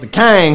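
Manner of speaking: Kang with an A, rhyming with bang; Kang for King. Real, not acted. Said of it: "K A N G. Not K I N G".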